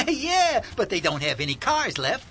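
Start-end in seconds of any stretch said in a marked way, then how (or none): 0.0-0.6 laughing as he says yeah, higher and louder; laughing yes. Acted. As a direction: giggle out a cheerful yeah. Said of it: "you're awful happy about it".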